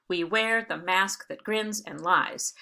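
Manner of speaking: slow scansion, stressing WEAR, MASK, GRINS and LIES; iambic stresses. Shown as a demonstration. Teacher going back to Dunbar to show iambs, stressing every second word. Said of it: "each of these is an iamb".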